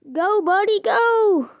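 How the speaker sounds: high, excited send-off; rising pitch, go buddy go; cheering. Real, not acted. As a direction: cheer him on like a launch. Cheering Bob off to space.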